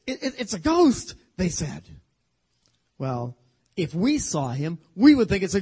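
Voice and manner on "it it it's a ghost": high, loud, stammering fright at a ghost; terrified cry. Acted. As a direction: stutter it out in sudden terror. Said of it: "they were terrified".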